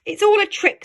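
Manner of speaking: high, loud protest; cross little child. Acted. Child on a stump, high loud protest. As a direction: stamp it out like a sulky child. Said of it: "said the Gruffalo's child".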